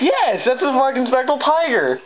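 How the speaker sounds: high, thrilled yes; barely containing the excitement; real elated squeal; giddy disbelief. Real, not acted. Confirming the rare tiger card.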